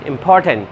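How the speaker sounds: "important" with a strong aspirated P on POR; "important" with weight on POR; stress on P. Shown as a demonstration. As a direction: hit the P in "important". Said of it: "पी पे यहाँ पर stress".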